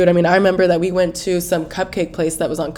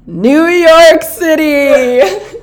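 excited; 8 semitones higher, 8 dB louder, much slower than usual. Real, not acted. Dramatic build-up to topic.

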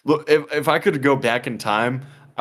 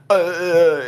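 mock stutter; 6 semitones higher, 3 dB louder than usual. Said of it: "he started stuttering".

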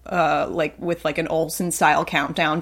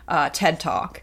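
usual speaking voice

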